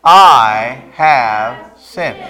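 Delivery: very slow group repeat of I have sinned; slow unison recitation. Shown as a demonstration. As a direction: say it together, stretching every word. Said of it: "Say it with me".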